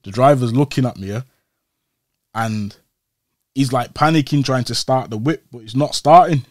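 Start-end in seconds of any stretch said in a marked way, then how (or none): none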